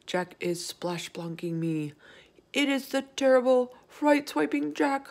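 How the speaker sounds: frightened giant wailing from a nightmare; theatrical, mostly high-pitched wail of fear; panicked bellow. Acted. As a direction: cry out each accusation in rising panic. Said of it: "bellowed the flesh lump eater".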